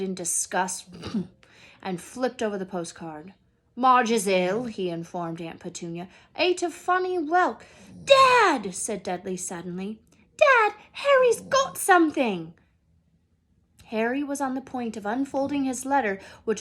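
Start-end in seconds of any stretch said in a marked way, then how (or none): 3.8-7.6 loud, blustering announcement; Uncle Vernon. Acted. Husband loudly informing his wife about his sister. 8.0-8.7 high, loud cry of Dad; Dudley calling out. Acted. Son suddenly calling his dad, loud and high. 10.4-12.5 high, loud bratty tattle; tattling Dudley. Acted. Son tattling on Harry to his father, loud and high.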